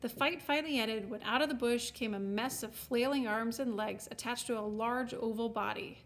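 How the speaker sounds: ordinary delivery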